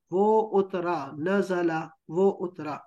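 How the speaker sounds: وہ اترا drawn out very slowly for writing down; slow dictation. Shown as a demonstration. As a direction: say وہ اترا slowly so students can write it. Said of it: "اس کو لکھیں please".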